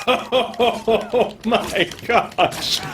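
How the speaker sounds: usual speaking voice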